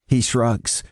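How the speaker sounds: ordinary delivery, nothing marked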